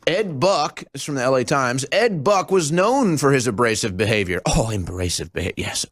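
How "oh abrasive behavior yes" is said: sarcastic mock. Sarcastic repeat of news phrase.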